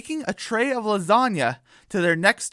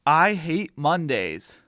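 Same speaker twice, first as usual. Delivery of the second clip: ordinary delivery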